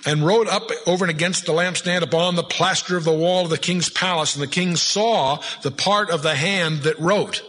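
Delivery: measured, solemn Bible reading; scripture reading. Acted. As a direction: pulpit reading of a Bible passage. Reads scripture aloud.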